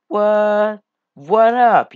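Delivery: high, loud surprised what; startled shout. Real, not acted. Gamer startled by something in game, loud high surprise. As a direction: jump at the shock.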